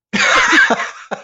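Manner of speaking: high-pitched cackling laugh; maniacal laugh. Real, not acted. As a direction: burst into a shrieking giggle. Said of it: "Like, maniacally laughing".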